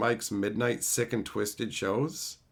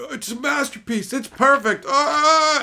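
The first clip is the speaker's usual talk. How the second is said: mock wail, high and building louder; anguished awe; 7 dB louder than usual. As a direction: act out agonized rapture, ending in a loud high cry. Acting out a rival's exasperated awe.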